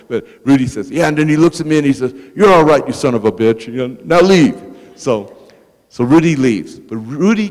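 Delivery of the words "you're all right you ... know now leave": loud, gruff blessing with a bark; crusty congressman. Retold or imitated. Congressman grudgingly approving Rudy, loud and crusty. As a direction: slap him on the back with a rough growl. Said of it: "Rudy has very crusty language".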